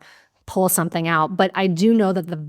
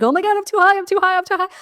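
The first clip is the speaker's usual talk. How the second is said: high, loud frantic repeat; hammed-up panicked spiral; mock panic; 13 semitones higher, 5 dB louder, much faster than usual. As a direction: play the freaked-out stoner.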